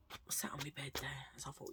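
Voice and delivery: soft, breathy aside; whisper. Real, not acted. Telling viewers about sitting on the bed in a whisper. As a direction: drop to a whisper.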